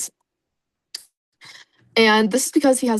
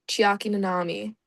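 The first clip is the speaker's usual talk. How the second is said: ordinary talk, nothing marked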